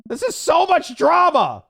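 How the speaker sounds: exasperated cry. Acted. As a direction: wail it out. Daughter exclaims overwhelmed.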